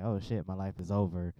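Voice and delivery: ordinary talk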